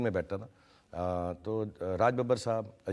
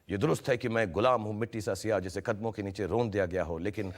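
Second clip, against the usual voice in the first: ordinary talk, nothing marked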